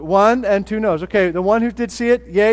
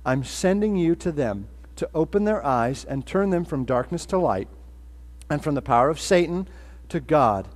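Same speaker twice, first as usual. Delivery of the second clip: soft, lower, solemn reading; reading Scripture; 4 semitones lower, 5 dB quieter than usual. Acted. Pastor reading Jesus' words to Paul, solemn and soft. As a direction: read the words of Jesus with reverence.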